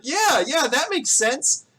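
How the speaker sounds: high, loud chiming-in; high-pitched crowd approval; townspeople agreeing. Acted. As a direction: agree eagerly as a village crowd. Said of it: "kinda chime in and agree".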